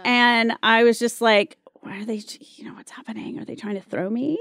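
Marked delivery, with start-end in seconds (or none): 1.8-4.4 hushed, voiceless aside to yourself; worried whisper. Retold or imitated. Actress to herself after rivals' remark, retold worried whisper. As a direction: whisper it like a private, puzzled thought. Retold private worry at audition.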